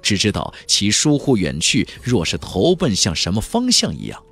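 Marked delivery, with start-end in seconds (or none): none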